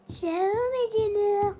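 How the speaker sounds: high, slow put-on greeting; hammy YouTuber intro voice. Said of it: "quand j'ai dit par exemple".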